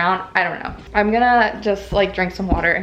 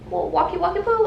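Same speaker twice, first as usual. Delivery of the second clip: talking to a dog; 6 semitones higher than usual. Real, not acted. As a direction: coo at the dog. Greeting her dog.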